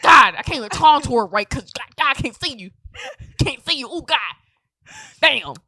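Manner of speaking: loud, high mock exasperation; hammed-up loud complaining; berating mom. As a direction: wail it like an overdramatic mom. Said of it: "berating me like a mom".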